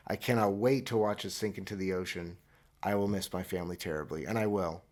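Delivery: usual speaking voice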